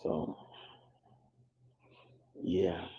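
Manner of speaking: sleepy, drifting whisper; fading out half asleep; nodding off. Real, not acted. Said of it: "I'm so sleepy".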